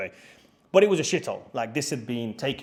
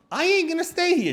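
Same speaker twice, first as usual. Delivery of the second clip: exasperated ultimatum; 4 dB louder than usual. Retold or imitated. Retold ultimatum to host.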